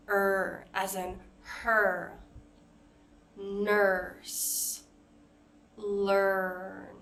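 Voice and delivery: "Er," "her," "nurse," "learn." said with the tongue pulled back for the R; teacher models the "er" vowel; er sound. Shown as a demonstration. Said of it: "er sound".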